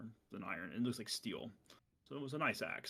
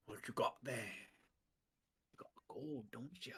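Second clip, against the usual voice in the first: sly hush; 7 dB quieter than usual. Acted. Bandit quietly eyeing old man's gold.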